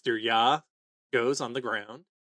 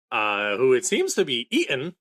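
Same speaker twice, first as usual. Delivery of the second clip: ordinary talk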